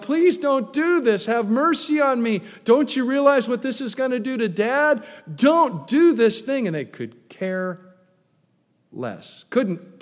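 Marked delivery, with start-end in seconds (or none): 0.0-6.7 high, loud begging cry; desperate pleading. Retold or imitated. Young Joseph begs his brothers not to sell him, a high loud plea. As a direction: beg them to spare him. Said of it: "Joseph was crying out".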